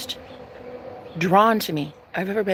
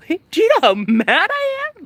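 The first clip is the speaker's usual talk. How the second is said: much higher, louder, laughing half-shout; laughing outburst; 11 semitones higher, 5 dB louder than usual. Real, not acted. Vlogger tells viewers how mad she is, laughing outburst. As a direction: burst out laughing mid-rant.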